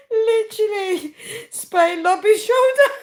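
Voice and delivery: high words garbled by laughter; uncontrollable laughing. Real, not acted. Streamer reacting to comments, unintelligible with laughter. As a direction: push it out loudly through the giggles. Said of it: "I need to stop laughing".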